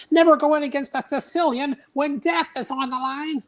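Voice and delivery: high, far-off Vizzini voice; squeaky Sicilian boast; Vizzini impression. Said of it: "character development".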